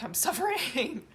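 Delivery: tearful laugh mid-sentence; laughing through tears. Real, not acted. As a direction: laugh out the word suffering in relief. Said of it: "so I'm laughing 'cause I'm relieved".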